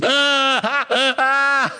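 crazed cackle. Acted. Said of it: "ein gackendes, irres Gelächter ausstieß".

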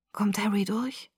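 very soft whisper; hushed hoarse question. A narrator's reading. Bella asking if the sick man will survive, hushed whisper. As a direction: ask it with barely any voice. Sore-throated girl asks about sick man.

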